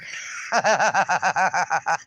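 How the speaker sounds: long, low rolling chuckle; real laughing. Real, not acted. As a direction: laugh helplessly at being teased. Laughs at swear jar comment.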